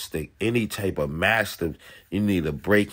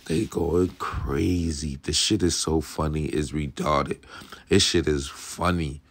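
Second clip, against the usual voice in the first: usual speaking voice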